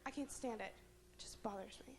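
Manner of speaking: soft, nearly whispered complaint; muttering under breath. Acted. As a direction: let it fade to a hushed grumble. Trails to whisper.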